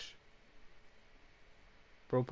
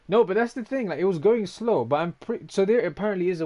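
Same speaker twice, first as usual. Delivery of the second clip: high, loud, fired-up protest; excited protest; 7 semitones higher, 7 dB louder than usual. Real, not acted. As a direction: defend yourself to chat, all worked up.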